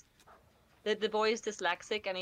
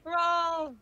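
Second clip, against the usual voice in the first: loud, high urgent shout; 7 semitones higher, 11 dB louder, much slower than usual. Real, not acted. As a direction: yell the command. Urging teammate to crawl.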